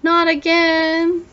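slow, high wail. Acted. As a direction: drag the wail out in dismay. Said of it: "Crickwing wailed".

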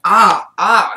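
short hot-mouth gasps; loud pained burning gasps. Real, not acted. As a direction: gasp through the burn. Burning tongue.

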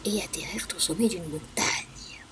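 soft storybook whisper; whispered telling. A narrator's reading. Storyteller whispering the landing to the children. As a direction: breathe it out in a hush.

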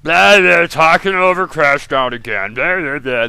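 exasperated mock chatter; mocking blah blah. Real, not acted. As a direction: brush off the dialogue with sarcastic blahs.

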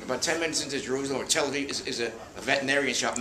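ordinary talk, nothing marked

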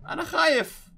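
put-on high, tearful voice of a frightened little boy; scared crying child. Player voicing the lost boy, crying that he's scared. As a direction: whimper it like a lost kid.

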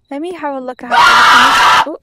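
sudden loud fast shout; abrupt loud exclamation; loud burst. Real, not acted. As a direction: blurt it out loudly. Sudden loud burst exploring room.

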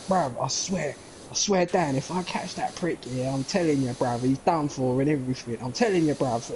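imitated Cockney tough-guy patter; put-on London geezer; London accent. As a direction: swear it like a Guy Ritchie gangster, London accent. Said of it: "he would do a spot-on London accent".

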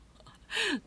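short, high burst of laughter; amused chuckle. Real, not acted. Host amused by their own casual phrase, short laugh. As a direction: let out a quick laugh.

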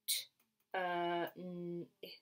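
"T, uh, n, ip" as separate sounds; sounded out. Shown as a demonstration. Teacher segments a word for children, sounded out "T, uh, n, ip". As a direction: say "T, uh, n, ip" sound by sound, with gaps. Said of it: "only makes one sound".

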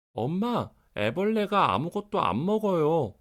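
put-on high, alarmed child voice; a little boy crying out in alarm; startled child. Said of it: "깜짝 놀라서 소리쳤어요". (깜짝 놀란 아이 목소리)

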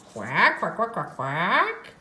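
imitated duck quacks; duck quacking. Storyteller introduces the duck with imitated quacks.

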